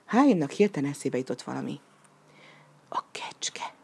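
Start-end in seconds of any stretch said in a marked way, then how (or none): 2.9-3.7 hushed whisper, afraid to be overheard; breathless secret whisper. A narrator's reading. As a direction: whisper it so the goat won't hear. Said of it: "suttogta halkan".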